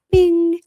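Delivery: high, loud imitated text chime; ping like an incoming message; phone alert ding.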